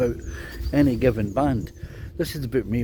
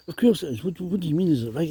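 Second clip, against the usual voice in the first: higher-pitched, mock-incredulous retort; acting out puzzled bandmates, higher voice; 8 semitones higher than usual. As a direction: throw the question back, voice pitched up. Bandmates answer his doubtful question.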